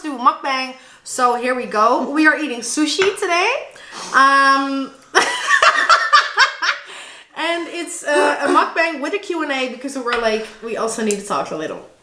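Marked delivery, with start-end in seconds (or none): 5.1-7.0 bursting into a high giggle. Real, not acted. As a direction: break into a giggling fit. Giggling fit mid intro.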